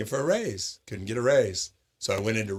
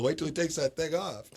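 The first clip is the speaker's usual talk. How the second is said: teasing; 7 semitones higher than usual. Retold or imitated. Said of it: "And I'm like".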